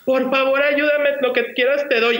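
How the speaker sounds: high-pitched mock begging; retold tearful plea. Crying woman begs the reader for help, pleading. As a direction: plead high, imitating a desperate client. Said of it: "llora y llora".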